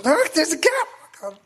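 high, loud laughing mock panic; hammed-up fright at the train gap; mock terror. Acting out a passenger panicking at the train gap, mock terror. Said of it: "Are you terrified of that gap?".

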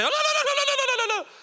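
very high, loud, laughing string of no's; mock slain-in-the-Spirit wail. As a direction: ham up a man falling under God's power. Mocking someone slain in Spirit.

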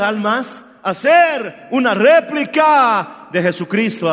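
loud preacher building to a shouted gloria; full-force preaching. Acted. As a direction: drive it home to an exultant cry.

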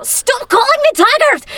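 high, loud, slow Scottish shout; imitating a Scottish friend. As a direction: snap back like she's sick of the joke. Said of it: "she would always just go".

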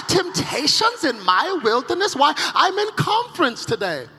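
mock outrage, key words stretched out; shocked churchgoer. Pious listener scolding the preacher for asking, affronted. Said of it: "Some of you are shocked I even asked".

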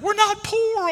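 very high, loud squealed imitation; mock crying jubilation. Son celebrates the surprise check, imitated in high tearful glee. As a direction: squeal it like a child crying and dancing. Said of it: "He's crying and dancing. He's like".

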